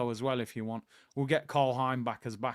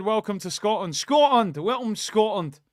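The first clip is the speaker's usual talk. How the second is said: hammed-up Scottish accent, raised and loud; amateur Glaswegian impression, pitched up; 5 semitones higher, 6 dB louder than usual. Shown as a demonstration. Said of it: "Is that a good Scottish accent?".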